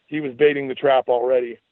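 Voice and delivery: plain reading voice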